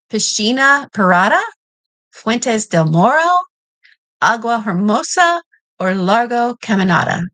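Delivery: Spanish names in a Kentucky accent, stumbling; Kentucky accent on Spanish words; mangled Spanish in Kentucky accent. Shown as a demonstration. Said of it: "get my mangled Kentucky get, accent here".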